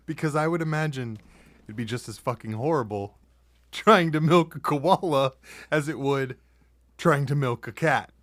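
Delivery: high, loud, incredulous rant; excited rant. Real, not acted. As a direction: build it into a shouty rant. Laughing rant about milking koalas.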